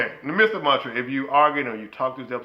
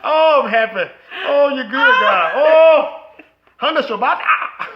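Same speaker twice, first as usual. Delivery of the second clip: loud, high celebration with laughter; joyful shout; 10 semitones higher, 8 dB louder, slower than usual. Real, not acted. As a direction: whoop like your prayers were answered. Celebrating wife saying it.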